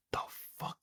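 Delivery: hushed, breathless disbelief; stunned whisper. Real, not acted. Watching the monster give birth, whispered shock. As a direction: barely voice it, jaw dropped.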